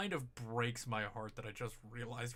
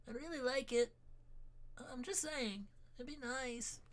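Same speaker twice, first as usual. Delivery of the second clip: put-on very high, cute cat-girl voice; cutesy baby talk; 12 semitones higher than usual. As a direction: beg for salmon like a kitten. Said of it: "Uwu voice comes from the Neko".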